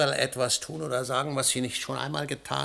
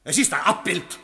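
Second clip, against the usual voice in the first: much louder and higher, cracking with rage; raging shout; 7 semitones higher, 6 dB louder than usual. Acted. Husband screams at his wife that the child is not real, raging. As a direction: scream it at her.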